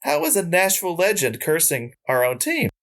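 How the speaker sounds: a sudden high, squeaky "What?"; shocked disbelief. Retold or imitated. Shocked by the ghost's curse, mock disbelief. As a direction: jump up in pitch in mock shock.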